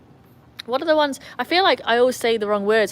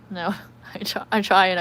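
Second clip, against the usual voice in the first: ordinary talk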